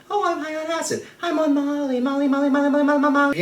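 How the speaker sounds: very high-pitched mock druggie voice; silly high festival-goer. High festival-goer answering the interviewer, silly squeaky imitation. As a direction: squeak it out as a spaced-out partygoer, repeating on and on. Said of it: "going up to people being like".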